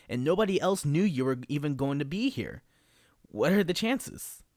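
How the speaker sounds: excited. Acted. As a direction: gush like a thrilled fan. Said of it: "He's so excited".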